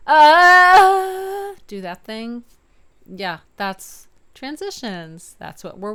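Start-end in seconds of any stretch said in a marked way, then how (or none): 0.1-1.5 high, loud yelp cracking over the break; register pop. Shown as a demonstration. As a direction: flip up through the passaggio. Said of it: "like, pop".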